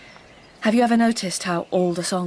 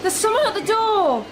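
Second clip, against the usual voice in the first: calling out; 14 semitones higher, slower than usual. Acted. Mum calling Fern to door.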